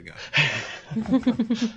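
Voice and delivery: loud, slow and deliberate; dramatic declaration. Acted. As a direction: announce the shot with gravity. Repeated, louder and slower.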